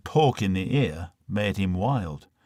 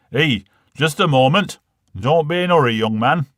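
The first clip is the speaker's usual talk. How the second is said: louder, higher call to stop a departing man; 4 semitones higher, 6 dB louder than usual. Acted. Father calls back the insurance man taking the money, raised voice. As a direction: shout him back before he leaves with the money.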